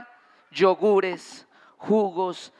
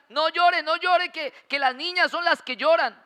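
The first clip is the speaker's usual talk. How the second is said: higher mock parent scolding, No llore repeated; mimicking scolding parents; 7 semitones higher than usual. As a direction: imitate a parent telling a boy not to cry. Voicing parents scolding boys.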